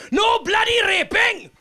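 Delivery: high, furious shout at the Vikings; feisty ancestor shouting down invading Vikings; feisty Welsh woman yelling. Retold or imitated. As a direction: scream it high like an outraged villager. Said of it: "when they came into her village going".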